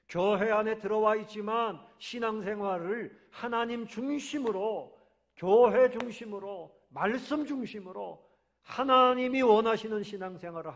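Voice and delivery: preaching loud. Real, not acted. Preacher calls the church to God-centered faith, loudly. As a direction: thunder the point home to the congregation.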